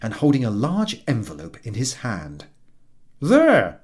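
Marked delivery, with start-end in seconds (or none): 3.2-3.8 high, loud triumphant call. Acted. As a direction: announce it proudly. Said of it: "with a triumphant air".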